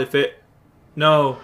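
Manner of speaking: normal talking voice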